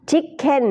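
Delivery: Chicken said high with a softened ch; chicken demo. Shown as a demonstration. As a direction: say Chicken lightly with a gentle ch. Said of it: "ชวาหน่อยๆ".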